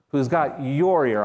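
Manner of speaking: slow, with "your" drawn out and punched; stress on your. Shown as a demonstration. Preacher speaks to you personally, stress on your. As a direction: hit "your" hard, aimed at the listener. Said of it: "A different emphasis on different words".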